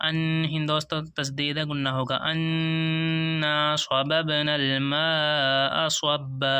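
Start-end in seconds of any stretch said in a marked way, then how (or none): 2.3-6.5 slow, drawn-out melodic tilawat; Quran recitation. Shown as a demonstration. Teacher reciting the verse to students in slow tilawat. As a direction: chant the verse slowly for the class.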